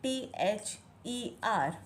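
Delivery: slow, each letter said apart; spelling letter by letter. Shown as a demonstration. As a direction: spell the letters out slowly for a child. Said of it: "मदर की spelling होती है".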